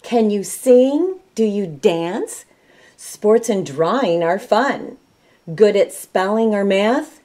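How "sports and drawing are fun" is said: usual speaking voice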